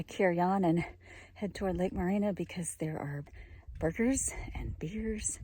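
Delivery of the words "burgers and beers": whispering. Real, not acted. Teasing the treat at the lake.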